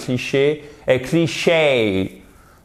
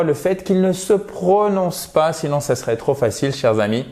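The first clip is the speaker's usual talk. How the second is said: normal talking voice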